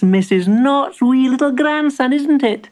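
high, eager shopkeeper voice; high-pitched storybook shopkeeper; welcoming shopkeeper. Acted. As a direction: greet the little customer in a high character voice.

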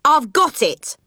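high-pitched burst of discovery; triumphant cry. Acted. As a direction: cry it out, delighted with yourself. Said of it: "I cried".

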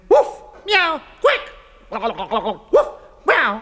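high imitated barks and mews; comic jumble of animal sounds; animal noises. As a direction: yap and mew like a silly confused pet.

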